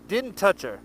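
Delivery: ordinary delivery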